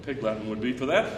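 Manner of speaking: ordinary delivery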